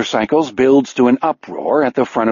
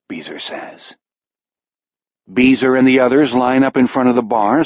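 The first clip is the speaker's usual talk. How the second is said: plain reading voice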